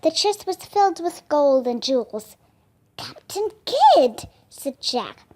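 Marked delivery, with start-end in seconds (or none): 3.0-4.3 surprised exclamation. A narrator's reading. Jack exclaims pirate's name.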